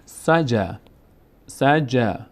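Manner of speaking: سجا with the final vowel held long; long alef sound. Shown as a demonstration. Teacher demonstrates short-alef words to learners, long final vowel. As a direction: stretch the end of سجا into a long aa. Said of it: "صدای آ".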